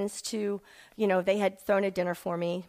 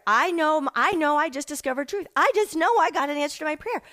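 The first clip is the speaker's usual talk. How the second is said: louder, higher, fervent declaration; reliving a thrilling discovery, louder and higher; excited insistence; 5 semitones higher, 4 dB louder than usual. Retold or imitated. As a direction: insist with eager conviction. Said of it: "But I'm like".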